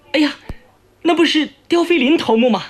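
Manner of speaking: high, quick call of recognition. Acted. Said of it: "高声喊道". (高声喊道)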